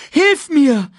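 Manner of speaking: high, loud plea for help; scared scream. A narrator's reading. Simba begs Sasu for help, screaming. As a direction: cry out in panic as the branch breaks. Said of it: "schrie Simba".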